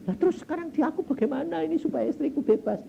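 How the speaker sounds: high, fast, hammed-up pleading; helpless high-pitched wail of a question; acted distraught plea. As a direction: imitate a husband at a loss. Retold husband pleading for help.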